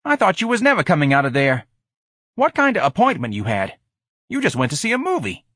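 high, complaining sneer. A narrator's reading. George kept watch over the wagon, now sneering his complaint. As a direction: sneer it as a fed-up street character. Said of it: "he sneered".

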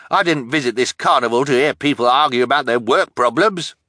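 loud, high-pitched complaint; cranky. A narrator's reading. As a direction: gripe crankily at the squabblers. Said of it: "the pimpled man said crankily".

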